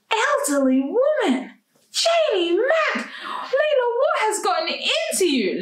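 high-pitched, drawn-out disbelief; aghast. A narrator's reading. Layla horrified by her own words, high aghast exclaiming. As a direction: squeal it in horror at yourself.

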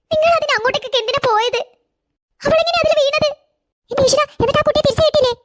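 high-pitched, urgent, horrified questioning; panicked. Acted. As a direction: fire off questions in dread for the child. Panicked questions about fallen child. (പരിഭ്രാന്തിയോടെ)

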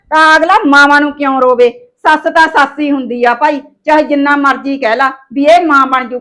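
ordinary talk

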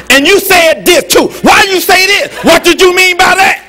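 loud, high-pitched confrontational demands; mock wife firing accusing questions, loud and high; arguing wife. Nagging wife to husband who wants to sleep, loud accusing. Acted wife restarting the argument.

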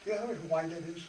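ordinary delivery

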